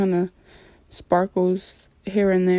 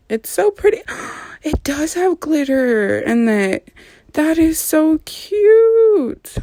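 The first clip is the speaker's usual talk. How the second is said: excited gushing; 8 semitones higher than usual. Real, not acted. Gushing over the glitter swatch.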